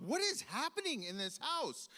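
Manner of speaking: high, incredulous outburst; exasperated disbelief. Retold or imitated. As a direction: imitate a kid baffled by his own family. Child outraged at unfair outcome.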